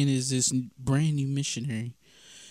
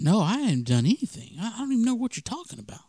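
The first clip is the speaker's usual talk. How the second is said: pleading denial; 8 semitones higher, faster than usual. Retold or imitated. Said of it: "continuing to freak out".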